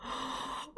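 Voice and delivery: barely audible gasp of horror; faint unvoiced gasp. Real, not acted. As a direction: draw a tiny shocked breath. Second realisation.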